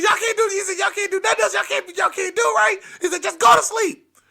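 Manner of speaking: high, loud scolding; exasperated grandpa orders. Retold or imitated. Grandpa sending the kids to sleep, high exasperated shout.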